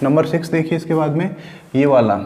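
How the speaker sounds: normal talking voice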